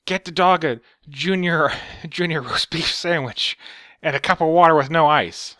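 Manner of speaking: high, loud hammed-up old lady ordering food; mock rich boss. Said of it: "she's like".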